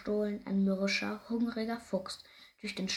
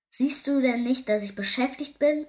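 louder, mildly annoyed retort; irritated weasel; 3 semitones higher, 8 dB louder than usual. A narrator's reading. Busy weasel dismissing baby hare, mild irritation. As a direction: brush them off loudly. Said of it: "entgegnet das Wiesel stirnrunzelnd".

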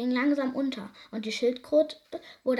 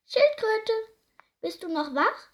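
a little mouse peeping up in the dark; tiny mouse voice, high and light; small mouse voice; 12 semitones higher, 6 dB louder than usual. A narrator's reading. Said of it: "eine kleine Stimme".

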